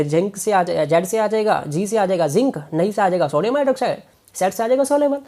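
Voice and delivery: normal talking voice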